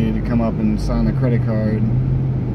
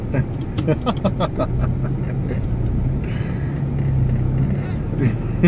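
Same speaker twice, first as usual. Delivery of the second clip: low, long chuckle; 11 semitones lower, 6 dB quieter than usual. Real, not acted. Laughing at own comeback.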